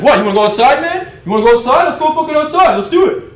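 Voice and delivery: high-pitched, full-volume aggressive shouting; imitated fight challenge. British guy daring him to go outside, shouting aggressively. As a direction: yell a challenge as the hothead.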